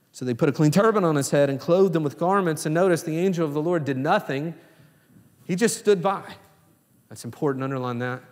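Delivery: usual speaking voice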